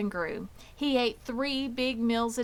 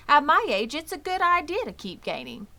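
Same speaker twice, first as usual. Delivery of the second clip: put-on higher, louder boyish pig voice; young pig voice; 8 semitones higher, 7 dB louder, faster than usual. Wilbur answers the old sheep, in a higher young pig voice. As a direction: chirp it out like a cheerful young pig.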